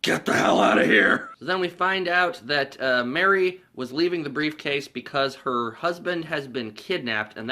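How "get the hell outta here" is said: raspy loud outburst; disgusted shout. Retold or imitated. Cop after drinking piss, mock disgusted shout. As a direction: spit out the disgust.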